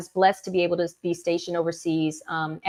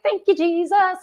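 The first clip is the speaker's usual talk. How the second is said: thank you Jesus; 11 semitones higher, 4 dB louder than usual. Retold or imitated. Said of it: "So I was like".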